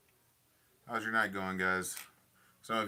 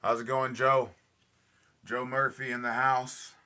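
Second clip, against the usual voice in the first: louder and higher, hyped welcome; loud greeting; 4 semitones higher, 10 dB louder than usual. Real, not acted. Streamer loudly greets Joe joining the stream, hyped. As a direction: call out to a friend joining.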